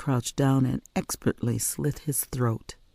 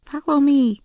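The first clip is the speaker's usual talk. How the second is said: put-on high, louder child's call; little girl command; 13 semitones higher, 6 dB louder than usual. A narrator's reading. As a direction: lead the way, urgent.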